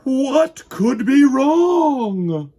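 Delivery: high, loud, slow wondering; suspenseful question. A narrator's reading. Reader building suspense for the children. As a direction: draw it out in mock puzzlement.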